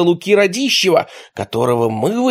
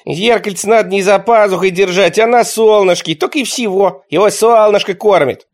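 put-on high, louder boyish know-it-all; lightly voiced kid; 7 semitones higher, 5 dB louder than usual. A narrator's reading. Nichevok lecturing the adults, a smug kid. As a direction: explain it like a smug boy.

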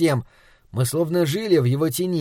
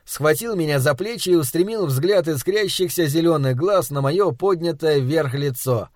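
usual speaking voice

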